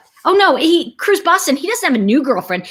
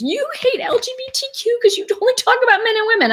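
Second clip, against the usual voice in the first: much higher, fast mock complainer voice; mocking a critic; 14 semitones higher, faster than usual. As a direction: squeak it out like an annoyed commenter. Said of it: "somebody who goes".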